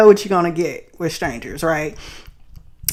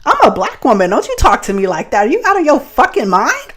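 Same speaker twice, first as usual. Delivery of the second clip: going off on him; 7 semitones higher than usual. Retold or imitated. She turns around and goes off on the catcaller, higher indignant yelling. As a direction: tell him off in the parking lot. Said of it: "I went off on him".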